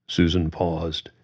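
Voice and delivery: usual speaking voice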